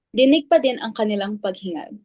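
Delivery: ordinary talk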